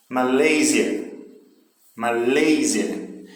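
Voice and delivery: slow "Malásia", English-style with a long, strong middle syllable; stress on middle syllable. Shown as a demonstration. Teacher drilling syllable stress for students, middle of Malásia stressed. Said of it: "a sílaba tônica está no meio da palavra".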